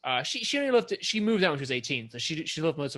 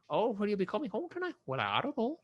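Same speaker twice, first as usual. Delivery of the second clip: put-on quick, lilting Minnesota accent; 7 semitones higher, 4 dB quieter than usual. Imitating people in Making a Murderer, Minnesota accent. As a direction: put on a sing-song Minnesota voice. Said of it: "picked up on the Minnesota accent".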